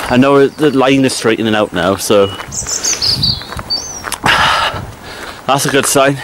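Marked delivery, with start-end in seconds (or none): 2.3-5.4 long breathy sigh of effort; a heavy exhale while climbing a steep path; tired sigh. Real, not acted. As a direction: let out a worn-out sigh mid-climb.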